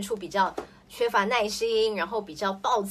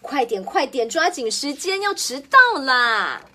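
angry hurrying; 3 semitones higher, 7 dB louder than usual. Acted. Mother angrily rushing her child out the door, loud cross hurry. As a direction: urge them on crossly, time is running out. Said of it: "妈妈总是很生气地说".

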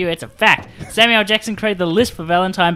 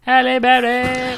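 mocking voice; 8 semitones higher, slower than usual. Mocking name, slow put-on voice.

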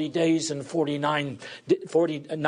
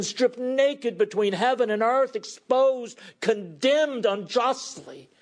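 impassioned preaching; 7 semitones higher than usual. Acted. Preacher telling the congregation of Christ's degradation, impassioned. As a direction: proclaim the shame of the cross with passion.

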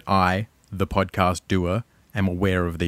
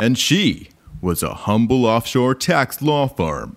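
ad voice; 2 semitones higher, slower than usual. Pretend. Mock ad voice.

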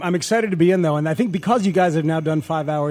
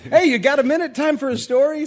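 bright, higher, chipper pitch; cheery hey, story time; cheerful storyteller; 7 semitones higher than usual. Pretend. Mock cheerful storyteller call.

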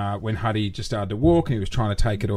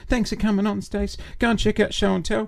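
high, sweet-talking send-off to a guest; mock-polite radio goodbye; fake politeness; 8 semitones higher than usual. Host seeing off a bad guest on air, falsely polite.

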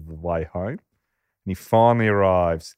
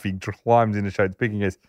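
normal talking voice

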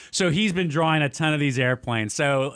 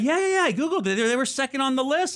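put-on kid voice; 11 semitones higher than usual. Son's eager reply voiced.

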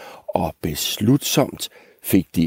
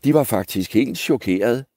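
ordinary delivery, nothing marked

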